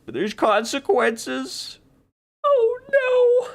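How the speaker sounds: high, slow pretend alarm rising to squeak; mock dismay. Portal user discovers there are consequences, squeaky mock dismay. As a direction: climb into a tiny squeal of hammed-up horror.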